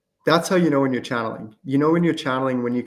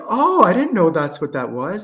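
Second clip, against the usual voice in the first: higher, lightly acted amazement; surprised realization; 6 semitones higher, 3 dB louder than usual. Student realizing it was channeling, surprised. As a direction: imitate a student just catching on.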